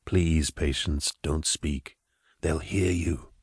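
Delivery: low, soft telepathic calm; Gideon's psychic voice. Acted. Gideon reassuring Patience telepathically, low and soft. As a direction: speak gently into her mind, calming.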